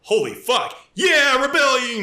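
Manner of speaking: high, loud rebel cheer; mock cheer. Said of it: "they're like".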